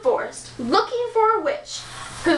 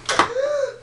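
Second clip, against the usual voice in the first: sharp gasp; 11 semitones higher than usual. Acted. Prince sees poisoned apple.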